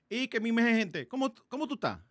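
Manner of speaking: loud, high Dominican Spanish; Dominican accent. Retold or imitated. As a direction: order food like a Dominican local. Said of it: "with a Dominican accent".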